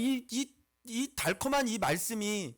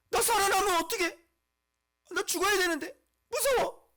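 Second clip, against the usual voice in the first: very high, squealing mock panic; hammed-up frightened little voice; mock fear; 11 semitones higher, 4 dB louder than usual. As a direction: play a scared whiner in falsetto. Acting people afraid to come alive.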